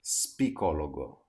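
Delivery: spicologo with the S said before the P; learner's mistake, spicologo with S before P; wrong spicologo. Shown as a demonstration. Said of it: "the first mistake you'll make".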